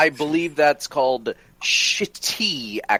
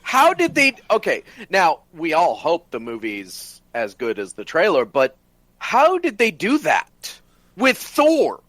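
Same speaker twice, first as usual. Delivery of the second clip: amazed excitement; 6 semitones higher than usual. Real, not acted. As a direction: gush about the trailer in disbelief. Amazed by Thor trailer.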